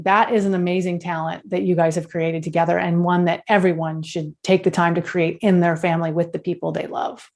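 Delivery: ordinary talk, nothing marked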